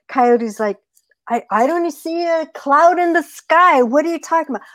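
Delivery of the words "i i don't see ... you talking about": high, questioning protest; incredulous coyote. Acted. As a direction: challenge the rabbit in a pitched-up voice. Coyote disbelieves the storm warning.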